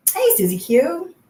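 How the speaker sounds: ordinary talk, nothing marked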